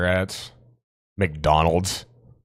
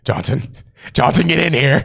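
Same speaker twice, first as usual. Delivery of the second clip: boss yelling; 6 semitones higher, 4 dB louder than usual. Pretend. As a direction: bark for Johnson louder. Boss calling employee.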